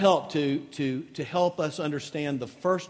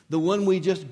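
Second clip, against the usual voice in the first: ordinary talk, nothing marked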